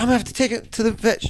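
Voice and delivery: high, fast mocking impression; mock lady voice. Mimicking the woman who wants the vet, high and fast. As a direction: squeak it out like a fussy lady.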